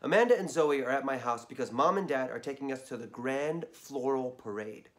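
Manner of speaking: ordinary delivery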